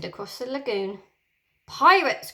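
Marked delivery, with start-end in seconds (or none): 1.7-2.3 loud, high, grinning shout of alarm; gleeful shout. Acted. Peter spots the pirates, gleeful shout. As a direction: yell it out with glee. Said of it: "cried Peter, grinning".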